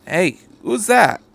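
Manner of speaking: sudden alarm at a stranger; startled call. A narrator's reading. Sato spots a figure in the dark, startled. Character spots someone, calls out.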